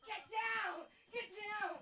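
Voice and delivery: high panicked "get down"; panicked mom. Retold or imitated. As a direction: yelp it like a worried parent. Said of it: "'cause I'm in a panic".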